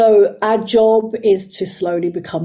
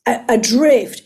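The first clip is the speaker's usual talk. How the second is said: ordinary talk, nothing marked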